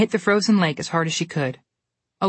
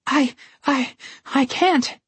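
high, stammering frightened whimper; 9 semitones higher, much slower than usual. A narrator's reading. Goldilocks on the ice before the bear, whimpering high. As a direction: falter as fear chokes the words. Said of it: "she whimpered".

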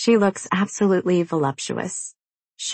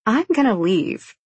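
threatening; 6 semitones higher than usual. A narrator's reading. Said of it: "Brianna threatens".

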